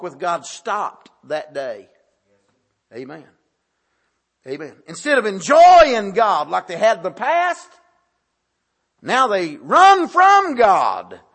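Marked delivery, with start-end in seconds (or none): 4.9-11.2 forceful preaching. Real, not acted.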